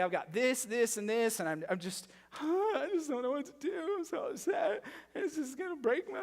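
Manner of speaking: high, teary mock sobbing voice; hammed-up high weepy voice; imitating a crying congregant. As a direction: whine it out like someone in tears. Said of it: "and they're crying".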